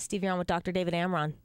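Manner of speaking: ordinary talk, nothing marked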